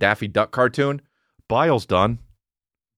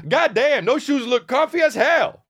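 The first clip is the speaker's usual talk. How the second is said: pitched-up mock holler; loud guy in bathroom. Stranger praising shoes too loudly, hammed-up holler. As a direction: imitate a man shouting across a bathroom. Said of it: "Just like that".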